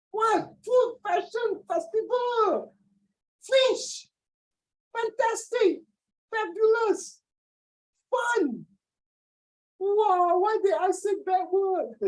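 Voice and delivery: slow, very high falsetto; hammed-up high squeal of innocence; mock innocence. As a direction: draw the words out high, jokingly. Joking innocence about F words, "just joke.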